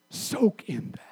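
hushed whisper. Real, not acted. Preacher to congregation about God never leaving, whispered. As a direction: let them soak in it, barely voiced. Preacher lets promise sink in.

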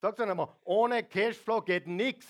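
loud, high driving sermon voice; booming preacher building up; preaching at full force. Acted. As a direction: press the point home hard.

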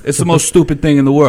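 fed-up rant at a stupid ban; angry outburst. Real, not acted. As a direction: blurt it out in real annoyance. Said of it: "When I get angry".

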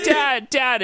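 put-on high-pitched pestering child; freaking-out kid. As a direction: imitate a panicky kid calling for a parent.

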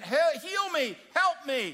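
loud, high cry for help; crying out for healing; desperate plea. Acted. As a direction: beg to be healed, voice up high. Blind man begging Jesus.

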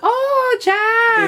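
very high imitated voice; Kate. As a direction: squeal it in a mock high-pitched woman's voice. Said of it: "she has such a high voice".

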